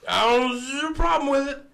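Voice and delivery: high mocking drunk ramble; drunk old guy voice. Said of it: "He was like".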